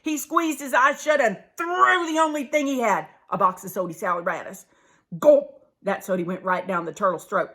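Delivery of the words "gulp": loud mock swallowing gulp. Turtle swallowing the sody, gulping loudly.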